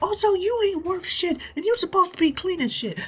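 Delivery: very high, loud falsetto woman; bossy pirate lady. Acted. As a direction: screech orders at the crew like a tyrant. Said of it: "We cut to this lady".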